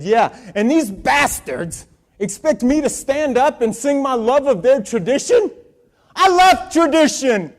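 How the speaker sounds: high, loud, tearful outburst, easing at the end; choked up. Retold or imitated. Teen bawling to his therapist about school tradition, choked up. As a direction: imitate a bawling teen's indignant rant. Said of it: "through a choked up voice".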